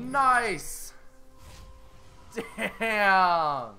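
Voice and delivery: loud, drawn-out exclamations; high, loud, stretched-out cheer; impressed cheering. Real, not acted. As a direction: holler approval at a great kill. Cheering the double kill.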